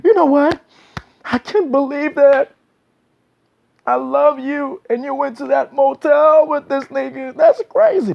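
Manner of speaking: put-on high-pitched, outraged boyfriend outburst; really upset. Upset man confronting his cheating woman, high and outraged. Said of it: "When he's really upset".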